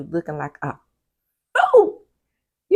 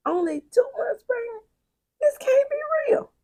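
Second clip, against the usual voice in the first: a hammed-up crying wail, much higher; fake-cry the disbelief up high; mock sob. Vlogger mock-sobbing over the show's timeline, much higher.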